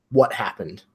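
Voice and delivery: normal talking voice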